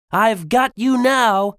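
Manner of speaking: high, loud storybook shout; triumphant shout. Acted. Po shouts at the wolf he chased, shouting. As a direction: shout it out, pitched well up. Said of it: "he shouted".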